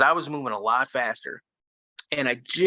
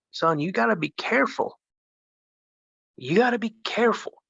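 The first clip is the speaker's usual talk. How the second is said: grandma's warning. Retold or imitated. Grandma warning about woods.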